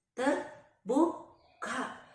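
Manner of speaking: terbuka broken into slow, stretched syllables; drawn-out suspense. A narrator's reading. Narrator shows eyes slowly opening, "terbuka" very drawn out.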